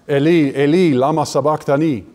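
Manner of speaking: ordinary talk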